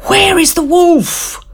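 high, urgent question from panting villagers; breathless villagers. Acted. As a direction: ask it as if out of breath from the climb. Panting villagers ask the boy.

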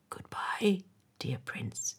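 faint whispered goodbye; dying swallow's whispered farewell; murmured. A narrator's reading. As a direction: breathe it out softly as he fades. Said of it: "he murmured".

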